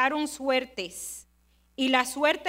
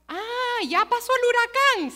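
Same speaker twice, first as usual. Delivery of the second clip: a lightly voiced dad, higher, just waking after the storm; 8 semitones higher than usual. Papi waking after the hurricane, lightly voiced sleepy relief. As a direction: mimic papi's sleepy, relieved announcement. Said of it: "se despertó papi".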